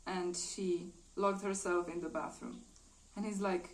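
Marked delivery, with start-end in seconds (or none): none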